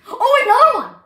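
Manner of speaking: high, loud burst of delight; excited shout. Real, not acted. Kid calling out another good card. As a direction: yell it out at the next card.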